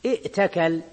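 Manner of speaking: ائتكل as i'takal, glottal stop before t; original with hamza. Shown as a demonstration. Teacher gives the root form, ائتكل with audible hamza. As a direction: catch the hamza clearly in ائتكل. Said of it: "إذن أصل الكلمة".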